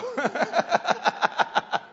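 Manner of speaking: laughter after his joke; high laughter after joking about his wife. Real, not acted.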